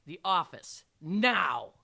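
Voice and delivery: loud, firm order; stern teacher's loud command. A narrator's reading. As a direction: order it forcefully, no argument. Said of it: "I commanded".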